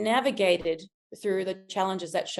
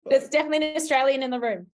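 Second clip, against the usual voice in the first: fast, laughing remark; 4 semitones higher, faster than usual. Real, not acted. Coach joking about an Australian, fast and laughing. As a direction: rush it out while still laughing. Still laughing after joke.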